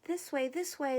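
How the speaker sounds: calling out. A narrator's reading. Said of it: "the bird called from the treetops".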